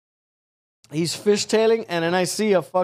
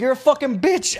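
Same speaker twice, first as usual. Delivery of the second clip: raised pitch, thrown out like a heckle; mock angry insult; 7 semitones higher than usual. Driver to the woman before leaving, mock angry. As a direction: imitate a driver cursing someone out.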